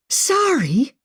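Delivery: small, high squeaky apology; squeaked. Acted. Maggie apologising to Emma with a high squeak. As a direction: squeak it out, sheepish. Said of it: "Maggie squeaked".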